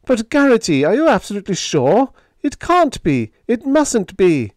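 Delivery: high, loud, disbelieving cry; crying out in dismay. Acted. Biggs hearing bad news from Garrity, crying out in dismay. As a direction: protest loudly, pitch leaping up. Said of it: "cried out".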